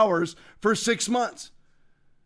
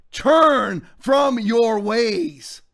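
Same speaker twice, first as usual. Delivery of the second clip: slow exhortation; 4 dB louder, much slower than usual. Real, not acted. Preacherly exhortation.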